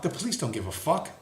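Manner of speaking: normal talking voice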